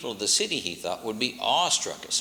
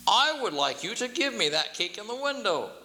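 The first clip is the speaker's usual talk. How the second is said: haughty king; 10 semitones higher than usual. A narrator's reading. King repeats demand.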